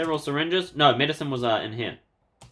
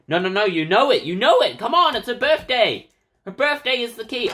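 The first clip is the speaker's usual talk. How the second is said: high, loud eager push; excited urging; 10 semitones higher, 5 dB louder than usual. Real, not acted. Figuring out the password, excited high urging. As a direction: egg yourself on, fired up.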